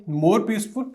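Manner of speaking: usual speaking voice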